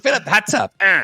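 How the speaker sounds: high, whooping hot-tub impression; Eddie Murphy. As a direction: yelp it out in a high voice. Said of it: "That's Eddie Murphy in it".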